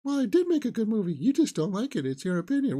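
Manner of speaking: high-pitched, indignant comeback; mock defensive director. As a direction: protest like a touchy filmmaker under criticism. Acts director's defensive retort.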